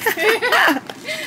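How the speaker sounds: squealing high laugh. Real, not acted. Husband jokes in tub.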